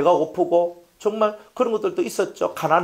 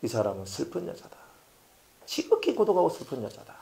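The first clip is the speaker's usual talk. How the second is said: voice of the Lord; 4 semitones lower, 7 dB quieter than usual. Retold or imitated. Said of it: "주님께서 말씀하시기를".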